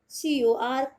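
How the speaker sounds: ordinary talk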